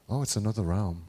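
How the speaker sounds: low-pitched, lightly acted reply; offhand answer. Believer answering how does that work, low knowing reply. Voices answer in imagined dialogue.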